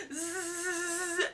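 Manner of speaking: long, high sustained zzz; imitated taser buzz. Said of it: "It was like".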